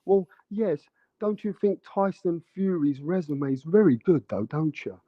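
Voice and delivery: mock posh Queen's English accent. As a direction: put on a hammed-up posh British accent. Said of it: "that posh English, the Queen's English".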